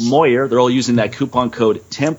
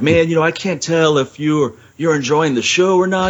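put-on drunk guy; 5 semitones higher than usual. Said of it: "he did the same thing".

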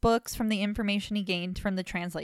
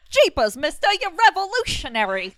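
put-on high, loud gee-whiz kid; wholesome 1950s kid; 9 semitones higher, 8 dB louder than usual. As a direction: gush like an awestruck fifties kid.

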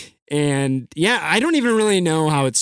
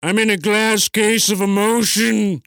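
high, slow, overwrought anchorman; hammed-up anguished movie line; Ron Burgundy; 8 semitones higher, slower than usual. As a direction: wail it melodramatically, drawn out. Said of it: "give me another line from Anchorman".

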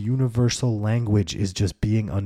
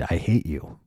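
normal talking voice

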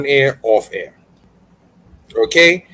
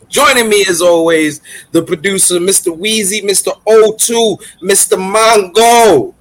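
grand entrance announcement; 4 semitones higher than usual. Acted. Introducing the podcast co-hosts.

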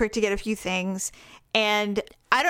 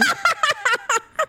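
laugh. Retold or imitated. Barking back at dogs.

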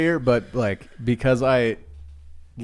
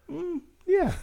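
much higher, hesitant mock coworker voice; imitating Serge; 3 dB quieter than usual. Retelling coworker Serge, a high hesitant imitation. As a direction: play the confused driver answering vaguely.

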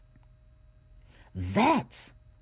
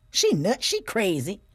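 ordinary talk

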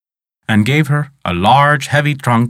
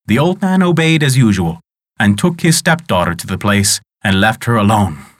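usual speaking voice

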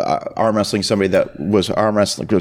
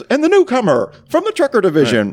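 mock announcer; 13 semitones higher than usual. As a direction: announce the newcomer with fanfare. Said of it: "he goes".

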